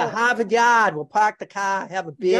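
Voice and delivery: broad Boston, every final R dropped; Boston accent. Shown as a demonstration. Guest joining in with the stock phrase, Boston accent. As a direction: play up the Boston vowels. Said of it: "you have a Boston accent".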